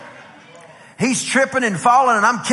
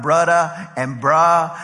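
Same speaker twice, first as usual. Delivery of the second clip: slow, lower Hawaiian-style "brudda" and "bruh"; imitated Hawaiian slang; 3 semitones lower, much slower than usual. Preacher imitating a flute player's Hawaiian type words, drawn-out island slang. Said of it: "saying all the Hawaiian type words like".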